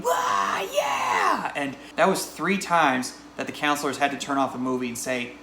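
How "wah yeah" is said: put-on hollering kids, voice leaping high; whooping mock cheer, shooting up in pitch; imitated cheering. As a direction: mimic a rowdy crowd of cheering kids. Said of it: "they were hooting and hollering and they were cheering".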